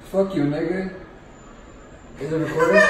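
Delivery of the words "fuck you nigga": usual speaking voice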